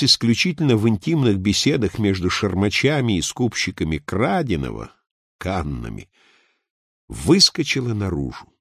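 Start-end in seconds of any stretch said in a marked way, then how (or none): none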